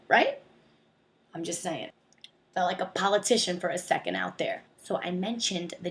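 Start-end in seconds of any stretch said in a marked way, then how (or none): 0.1-0.4 squeaky, loud rising right; high emphatic right. Acted. Ending her mock political rant with a squeaky right. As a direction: pop it up high for agreement.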